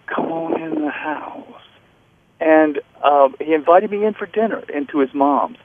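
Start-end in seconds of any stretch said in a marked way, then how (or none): 0.1-1.5 slow, hushed imitation of a friend leaning close; imitated soft invitation. Ailing champ leans over to invite his guest inside, imitated slow hushed voice. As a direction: draw it out softly, as if struggling to talk. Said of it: "he leaned over and said".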